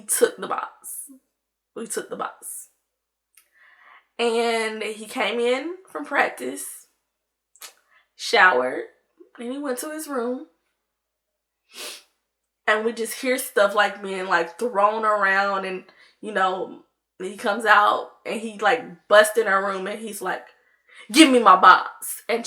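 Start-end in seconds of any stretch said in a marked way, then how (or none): 1.7-2.7 soft unvoiced whisper, like owning up; barely-there whispered repeat; hushed confession. Real, not acted. Same line repeated in a whisper. 21.1-22.2 imitated demand. Brother bursts in, demands box back.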